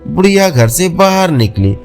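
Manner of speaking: usual speaking voice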